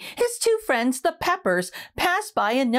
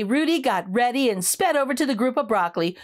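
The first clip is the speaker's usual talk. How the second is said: ordinary delivery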